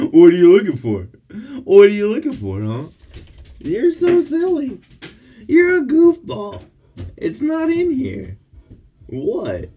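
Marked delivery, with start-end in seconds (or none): 0.1-8.4 high, loud teasing coo. Real, not acted. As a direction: tease the goofball dog, up high and loud. Teasing the dog.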